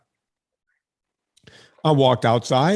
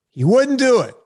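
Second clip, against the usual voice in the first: indignant outburst; 9 semitones higher, 8 dB louder than usual. Real, not acted. Indignant retelling of refusal.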